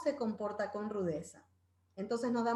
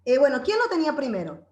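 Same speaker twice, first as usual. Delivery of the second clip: lightly voiced mom, louder; mom stepping in; 10 dB louder than usual. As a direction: raise your voice a little like a mom settling a squabble. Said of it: "Clásico, la mamá dice así".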